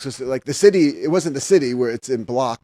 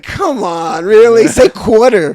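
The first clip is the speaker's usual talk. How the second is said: louder, higher New York coaxing; New York accent; 6 semitones higher, 9 dB louder, much slower than usual. Retold or imitated. Teasing the California girls, loud New York accent. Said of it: "I'm like".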